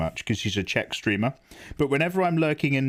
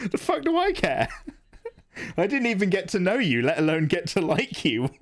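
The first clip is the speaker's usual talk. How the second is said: high, laughing mock outrage at the film; exasperated viewer; 6 semitones higher than usual. Streamer mocking his own reaction to a film character, high and laughing. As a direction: squeal it out, laughing, like a fed-up viewer. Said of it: "you're just like".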